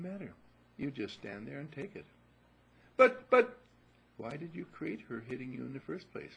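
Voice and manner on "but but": high, loud stammered protest; much higher and louder childish "but, but"; sputtering child. Acted. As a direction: splutter like a kid cut off. Boy protesting to parent.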